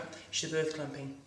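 normal talking voice

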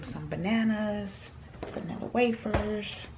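hushed morning whisper, people asleep. Real, not acted. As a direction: keep it to a whisper. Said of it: "I'm whispering 'cause people still sleep".

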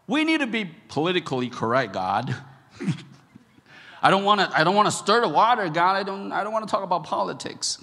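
high, loud, rushed nervous excuses; nervous pleading. Pretend. Preacher as Nathan making loud nervous excuses to God. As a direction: wriggle out of the job.

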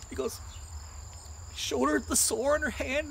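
ordinary delivery

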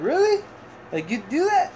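squeaky high grateful disbelief; delighted surprise. Retold or imitated. Retelling mom's offer, high happy surprise. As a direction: jump way up in happy shock. Mom offers to print flyers.

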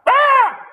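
piercing high shriek; panicked shriek; scream. Acted.

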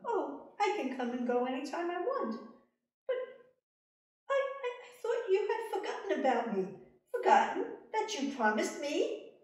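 high, loud, gleeful little man; little man demanding the queen's baby; sly little man. Acted. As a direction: gloat as the little man come for his prize. Little man claims the child.

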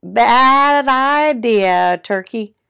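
loud, very slow rooster voice; storybook rooster, vowels dragged out; crowing rooster. A narrator's reading. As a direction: crow the words out, stretched. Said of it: "crowed Rooster".

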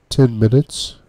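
wavering voice. Acted. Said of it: "her voice wavering".

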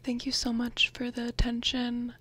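soft breathy whisper, close to the mic; ASMR whisper. Real, not acted. Vlogger whispering through her bag contents, ASMR style. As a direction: whisper like sharing secrets with a friend.

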